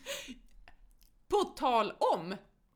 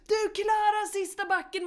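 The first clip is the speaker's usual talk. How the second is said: much higher, loud coaching yell; imitated coach yell; 12 semitones higher, 7 dB louder than usual. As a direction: shout encouragement up the last hill. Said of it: "Om inte hon hade skrikit".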